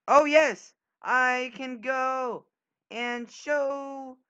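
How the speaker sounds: high, loud, slow gloat; delighted, drawn-out gloat; excited cheer. Real, not acted. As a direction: crow over a lucky move. Excited at good move.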